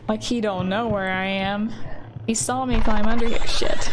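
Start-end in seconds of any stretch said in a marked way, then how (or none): 2.3-3.9 loud panicked outburst; alarmed shout. Real, not acted. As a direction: yell it in sudden alarm.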